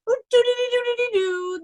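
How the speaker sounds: high shivering babble; madman's wordless chattering from cold; shivering doo-doo noise. Acted.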